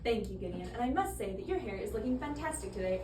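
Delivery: normal talking voice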